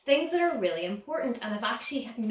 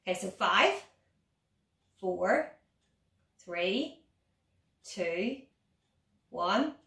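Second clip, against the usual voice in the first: ordinary talk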